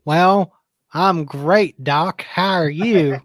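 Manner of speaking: slow, drawn-out Southern greeting; put-on Southern accent.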